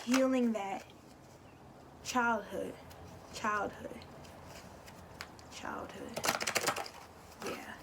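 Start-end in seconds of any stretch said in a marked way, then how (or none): 5.5-7.7 whispered. Real, not acted. Trails into a whisper.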